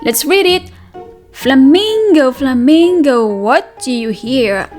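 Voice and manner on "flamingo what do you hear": usual speaking voice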